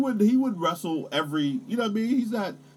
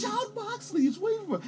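hyped taunt; 3 dB quieter than usual. Pretend. Hyped taunt listing wrestlers.

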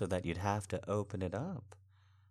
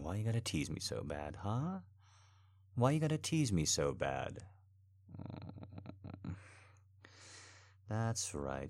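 ordinary talk, nothing marked

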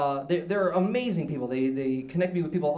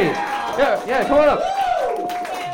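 slow, much higher, drawn-out welcome; stretched hype; 8 semitones higher, 4 dB louder, much slower than usual. Acted. Host waves the guest up to the stage, stretched excited call. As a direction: drag out the up like a cheering emcee.

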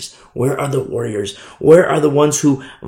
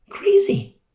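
even higher, near-crying cry; anguished cry. Real, not acted. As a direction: let the voice crack on it. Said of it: "preacher exclaims".

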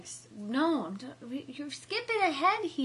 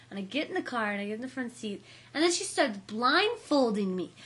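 ordinary talk, nothing marked

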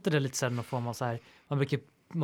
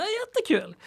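squealed much higher, hammed-up delight; mock cheer; 3 dB louder than usual. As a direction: overdo the joy as a joke.

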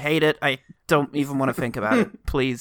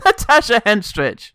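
mock compliment; 5 semitones higher, 5 dB louder than usual. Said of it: "how would you say Natasha Henstridge".

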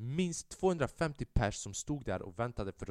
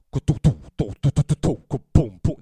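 loud rapid beatbox of punches; punch sound effects; 4 semitones higher, 15 dB louder than usual. Retold or imitated. Narrator imitating the punches landing, loud rhythmic beatbox. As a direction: rattle off the blows like a techno beat. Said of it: "Det var en technolåt".

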